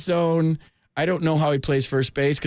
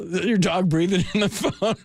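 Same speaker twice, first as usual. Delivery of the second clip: laughing question, pitched up; amused; 4 semitones higher than usual. Real, not acted. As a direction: ask it through rising laughter. Host laughs at caller's dog.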